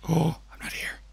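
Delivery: gasp, then breathy whispered hiding. Pretend. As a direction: hush it like you're ducking out of sight. Hiding from the masked people.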